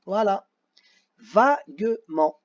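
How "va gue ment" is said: "Va-gue-ment" slowly, each syllable apart; syllable-by-syllable dictation. Shown as a demonstration. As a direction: break "Va-gue-ment" into three separate drawn-out beats. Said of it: "on va écrire les syllabes". (« Va-gue-ment » découpé lentement en syllabes)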